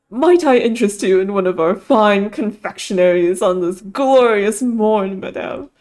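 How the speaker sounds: drawn-out, mock-grand posh waiter; posh accent. Narrator playing fancy waiter to surprise Gidget, posh accent. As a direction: put on your best posh waiter accent. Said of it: "in my best posh accent".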